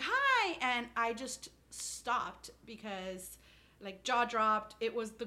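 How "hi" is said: high bright mock hello; sing-song high greeting; chirpy hi. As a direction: pop it out like a cheery neighbour. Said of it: "she's like".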